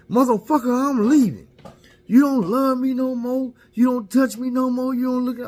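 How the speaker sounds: high, loud furious yelling; wife yelling she is leaving, furious; angry wife. Retold or imitated. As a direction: scream it at him as you walk out. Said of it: "she come out the room with bags".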